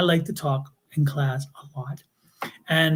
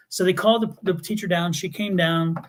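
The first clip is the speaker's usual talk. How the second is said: usual speaking voice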